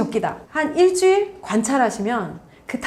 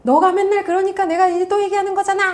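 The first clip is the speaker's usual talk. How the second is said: put-on much higher, nagging mother scolding her child; nagging mom; 10 semitones higher, 3 dB louder than usual. Shown as a demonstration. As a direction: play the blaming mom who starts with "you". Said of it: "이렇게 시작하시는 겁니다".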